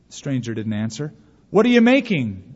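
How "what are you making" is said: loud, high-pitched demand; shouted question. A narrator's reading. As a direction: shout it out, higher and louder. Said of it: "one shouted even louder".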